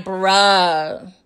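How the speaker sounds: low, long-stretched "Bruh"; drawn-out disbelief. Retold or imitated. Reacting to Saxe telling Jenny, low drawn-out disbelief. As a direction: drag "Bruh" out low, unimpressed.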